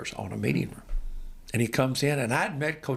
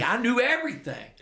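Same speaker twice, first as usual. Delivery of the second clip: much higher, amped-up repeat of I knew everything; a pitched-up insistent I knew everything; excited rise; 12 semitones higher than usual. Retold or imitated. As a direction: push the words up high, getting amped.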